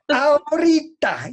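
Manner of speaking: high, loud, slow command; stern command. Retold or imitated. Master demands proof right now, loud and stern. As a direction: bark it back at him. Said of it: "me empieza a gritar así".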